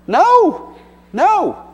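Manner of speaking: high, loud, emphatic No, no; a sharp, high double no. Real, not acted. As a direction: shoot the idea down with a ringing no. Emphatic answer to rhetorical question.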